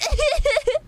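high-pitched burst of laughter; high giggle. Real, not acted. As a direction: break into a squeaky giggle. Laughs after spotting the cat.

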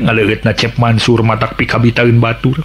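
ordinary delivery

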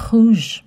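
rouge as roozh, falling and firm; French pronunciation. Shown as a demonstration. Teaching children rouge. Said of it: "Red in French is".

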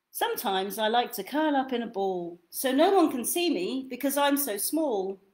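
gentle lively storybook read; reading to children. Acted. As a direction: read it like bedtime for a toddler. Reads second book aloud.

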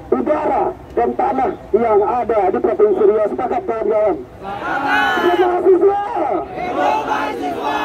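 rally demands read out; fiery revolutionary speech. Acted. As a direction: proclaim each demand to the crowd. (pidato demonstrasi yang berapi-api)

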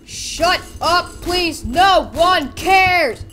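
loud, high, slow and punched; exasperated shout. Pretend. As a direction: yell each word separately in annoyance. Snapping at the game.

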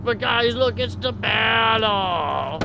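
PewDiePie impression, high and slow; high, slowed Swedish YouTuber voice; Swedish accent. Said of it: "I have a Swedish accent".

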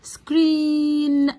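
"screen" in one long, level, held syllable; one unbroken syllable. Shown as a demonstration. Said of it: "بنطقها كلها كده على بعضها مرة واحدة". ("screen" مقطع واحد ممدود متصل)

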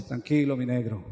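calm him like a loving wife; wife soothing her crying husband, tender and soft. Retold or imitated.

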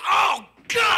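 loud high horrified cry; mock horrified shout; shocked crowd. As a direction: wince as he falls. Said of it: "Everyone goes".

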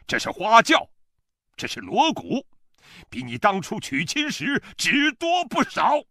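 high-pitched, furious harangue; shouted tirade. A narrator's reading. Father-in-law berates his son-in-law across the field, shouting. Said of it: "我丈人挥着手向我喊".

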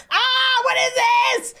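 high, loud frightened cry; startled shout. Real, not acted. Startled by something creepy on screen, shouting in fright. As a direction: yelp it out in real fright.

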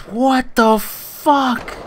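high, slow drawn-out what; horrified disbelief. Real, not acted. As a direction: stretch it out, appalled. Reacting to disgusting tags.

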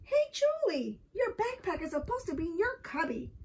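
put-on high, scolding child's yell; indignant yell. A narrator's reading. Michael scolds Julie over her backpack, indignant yell. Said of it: "yelled Michael".

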